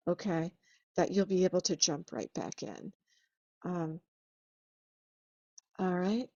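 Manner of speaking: usual speaking voice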